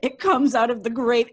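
normal talking voice

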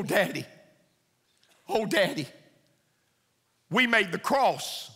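put-on high, pleading child's voice, lightly done; a touch of a weeping boy's voice; crying little boy. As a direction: plead like a tearful son telling his father.

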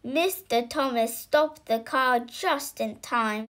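ordinary delivery

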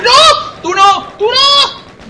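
high-pitched panicked yelling; panicked scream. Real, not acted.

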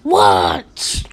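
loud, rough launch yell; shouted launch command. Pretend. Player firing the cat laser gun, shouted command. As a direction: belt out the firing order.

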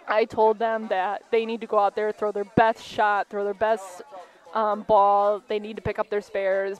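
ordinary talk

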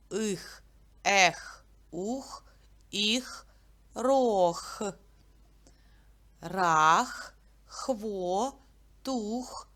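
Ах, ох, ех each punched loud and short; teacher drills reversed syllables. Shown as a demonstration. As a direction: bark each syllable out separately. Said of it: "І наоборот".

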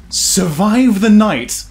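slow, drawn-out disbelief; incredulous. Real, not acted. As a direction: stretch it out in disbelief. Reading task in disbelief.

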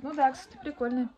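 ordinary talk, nothing marked

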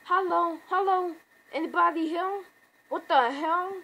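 high, loud panicked calling out; mock freaking out. Comic fan voicing Rick alone in the hospital, mock freaking out. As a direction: call out as if lost and alarmed. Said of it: "freaking out".

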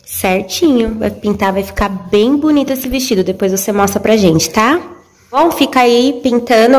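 ordinary delivery

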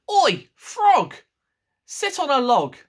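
loud, high bossy cat voice. Acted. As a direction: order the frog about like a pushy cat. Cat bossing the frog.

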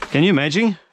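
high thrilled question; excited. Real, not acted. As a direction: ask it thrilled and loud. Repeated later calmer.

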